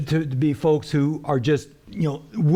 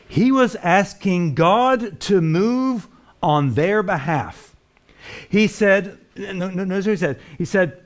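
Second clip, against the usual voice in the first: raised, driving sermon voice; preaching at full force; 4 semitones higher, 3 dB louder than usual. Acted. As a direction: preach it out to the congregation.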